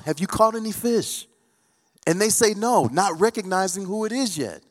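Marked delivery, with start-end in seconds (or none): 0.0-1.3 calling out. Retold or imitated. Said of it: "And he yells out".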